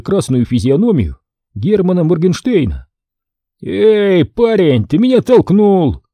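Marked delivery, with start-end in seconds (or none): 3.6-6.0 put-on drunk, slurring, raised voice; loud drunken slur; slurred drunk speech. A narrator's reading. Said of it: "заплетающимся языком объявил Герман". (пьяный заплетающийся голос)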